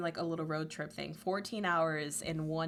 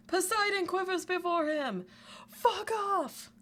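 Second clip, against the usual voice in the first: much higher mock yell; high shouted vine quote; 12 semitones higher than usual. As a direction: shriek it like the vine. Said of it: "One of them is".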